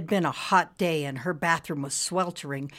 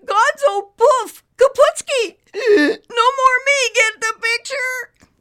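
high, loud, slow, tearful wailing cloud; hammed-up sobbing farewell; wheezing despair; 11 dB louder, slower than usual. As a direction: cloud wails that he is vanishing. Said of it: "Nimbus started to wheeze".

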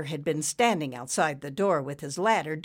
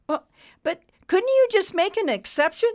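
very high, loud, halting plea; desperate pleading; 7 dB louder than usual. A narrator's reading. Borrower to departing banker, desperate pleading. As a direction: beg for a last chance. Borrower begs as door closes.

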